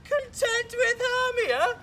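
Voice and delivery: high, slow mocking disbelief into a loud laugh; scornful laugh. Lysander mocks the notion of loving Hermia, scornful laugh. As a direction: laugh the idea away.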